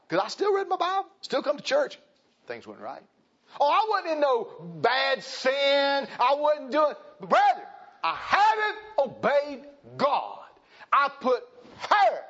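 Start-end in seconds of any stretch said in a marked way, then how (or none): 0.1-2.0 much higher, louder preaching lift on "still"; rising preacher. Acted. Preacher to congregation, climbing into louder preaching. 3.6-12.2 high, loud, drawn-out preacher cry; full-force preaching. Acted. Preacher confessing to his church, at full preaching force.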